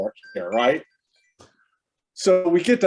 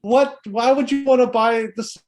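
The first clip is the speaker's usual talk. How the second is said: higher, laughing incredulity; amused disbelief; 7 semitones higher than usual. Retold or imitated. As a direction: question him, half laughing. Retelling his amused disbelief.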